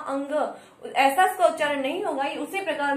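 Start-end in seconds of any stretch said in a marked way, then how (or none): 0.1-0.9 अंग with a full ng sound; anusvara demo. Shown as a demonstration. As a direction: close अंग on a clear ng. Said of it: "जैसा हमने अनुस्वार का किया था". (अंग में पूरा ङ की ध्वनि)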